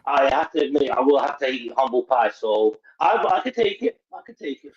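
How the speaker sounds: usual speaking voice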